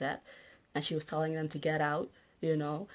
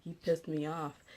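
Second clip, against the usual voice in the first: ordinary talk, nothing marked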